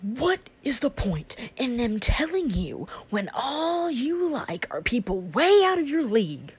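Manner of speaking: high, sneering imitation of a guy; mocking guy voice. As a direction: imitate him with a snide edge. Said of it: "I imagine like this".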